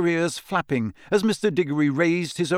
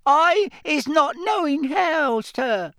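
high squeaky elf, gasping; frightened house-elf squeak; 4 dB louder, slower than usual. Acted. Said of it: "Winky gasped".

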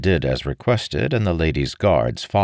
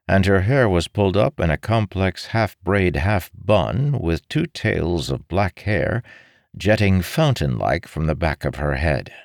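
plain reading voice

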